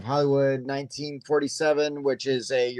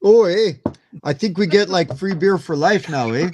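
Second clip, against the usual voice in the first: higher, hammed-up Canadian hoser; mock Canadian accent; 4 semitones higher than usual. Playing along about free beer, mock Canadian accent. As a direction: answer in a joking Canadian lilt.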